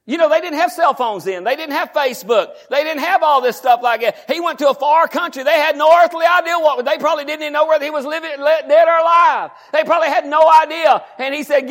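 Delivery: high, loud preaching climb; full-force preaching. Acted. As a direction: preach it up with fire.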